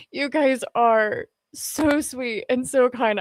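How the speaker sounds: normal talking voice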